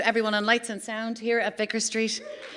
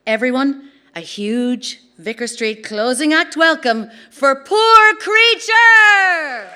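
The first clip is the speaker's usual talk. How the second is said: big band intro; 4 semitones higher, 6 dB louder, much slower than usual. Real, not acted. Host welcoming the closing band to the stage, rising shout. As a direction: ramp it up and blast the band's name.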